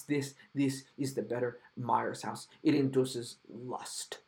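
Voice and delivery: parodying the director's smug pride, hushed and drawn out; mock Dominique. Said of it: "He was probably proud of that house".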